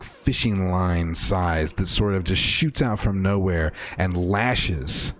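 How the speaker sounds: ordinary talk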